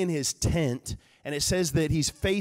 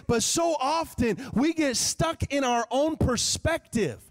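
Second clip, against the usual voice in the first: raised, higher preaching voice; 5 semitones higher than usual. Acted. Preacher urging the congregation, raised and higher. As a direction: drive the point home to the congregation.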